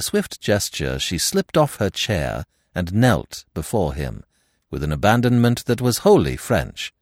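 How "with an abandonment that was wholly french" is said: ordinary delivery, nothing marked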